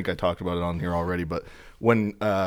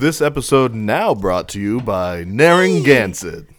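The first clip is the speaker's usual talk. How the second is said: mock ad read; 3 semitones higher, 5 dB louder than usual.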